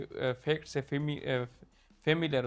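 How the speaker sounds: ordinary delivery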